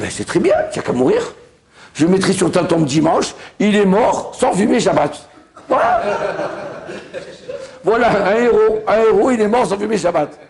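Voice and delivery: hammed-up triumphant outburst; mock proclamation. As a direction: proclaim it like a grand heroic epitaph. Mock epitaph delivered for laughs.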